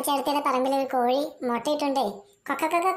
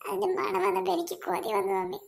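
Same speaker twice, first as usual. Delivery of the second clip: crying; 5 semitones higher, 4 dB quieter than usual. Acted. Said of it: "എൻ്റെ മോൻ ഇങ്ങനെ കിടന്ന് കരയല്ലേ?".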